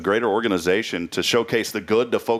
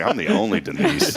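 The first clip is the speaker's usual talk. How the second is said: high-pitched playful boast; mock proud; 11 semitones higher than usual. Host teasingly voices a guest's boast, mock proud. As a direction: put on a proud high voice, half laughing. Mimics Denise claiming to be unique.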